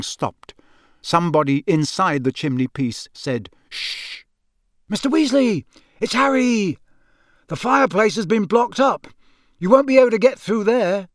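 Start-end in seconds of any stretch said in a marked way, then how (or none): 3.7-4.2 soft, drawn shush; hushing shh. Acted. Someone inside the chimney quiets boys, shushing. 4.9-11.1 high, raised, carrying voice; calling through boards. A narrator's reading. Boy to man stuck in chimney, calling. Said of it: "called through the boards".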